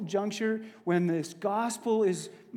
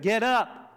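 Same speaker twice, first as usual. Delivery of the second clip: emphatic imperative on "Get up"; emphatic command; 4 semitones higher, 5 dB louder than usual. Shown as a demonstration. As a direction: GET UP as a firm, punched order. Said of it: "emphatic but imperative".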